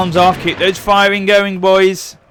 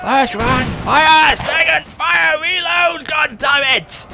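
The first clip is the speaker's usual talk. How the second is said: high, slow, exasperated commands; frustrated shouted orders; 8 semitones higher, slower than usual. Acted. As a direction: bark orders, losing patience.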